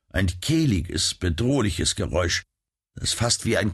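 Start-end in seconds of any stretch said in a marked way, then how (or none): none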